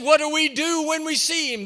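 high, loud mock drill-leader shout; rallying call. Helmeted woman rallies boys in church, high mock shout. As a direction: imitate a leader firing up the troops.

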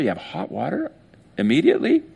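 high, astonished "immediately?"; amazed question. Acted. Villager hearing of instant hot water, high amazed disbelief. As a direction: gasp it out in disbelief.

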